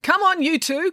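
high-pitched coach's call. A narrator's reading. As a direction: call to the two stragglers. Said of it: "called Mayor Darawal".

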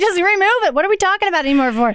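high, quickening friend's voice; impatient. Retold or imitated. Girlfriend Lisa saying just remove it, retold impatience. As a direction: imitate a friend dismissing the whole debate.

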